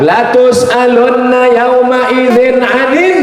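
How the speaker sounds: slow, high chanted verse; Quran recitation. Acted. Preacher reciting a verse to the congregation, slow and chanted. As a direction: intone the verse slowly and high.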